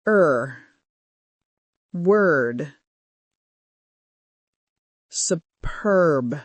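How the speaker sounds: Er, Word, Superb said low with a loose er sound; relaxed er drill. Shown as a demonstration. Teacher leads listeners through er practice, low and slow. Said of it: "Let's practice".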